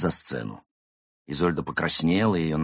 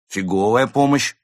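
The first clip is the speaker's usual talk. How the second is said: high, loud, eager concession; readily agreeing; 6 semitones higher, 9 dB louder than usual. Acted. Tipsy cat conceding to his friend, readily agreeing. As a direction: agree brightly and at once. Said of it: "с готовностью согласился Константин".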